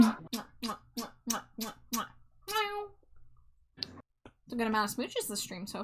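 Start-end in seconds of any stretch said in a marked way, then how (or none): 0.0-2.1 string of kiss sounds; big wet smooch. Pretend. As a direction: blow loud kisses. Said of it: "Is that a Smooch Your Wife ten pack?".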